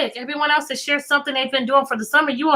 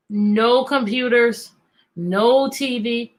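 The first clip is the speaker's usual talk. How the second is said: normal talking voice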